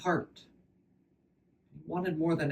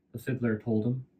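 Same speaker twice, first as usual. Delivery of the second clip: ordinary delivery, nothing marked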